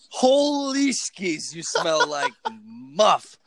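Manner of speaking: high, slow, drawn-out silly voice; goofy high voice. Pretend. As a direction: stretch it out in a goofy high pitch. Silly mock exclamation.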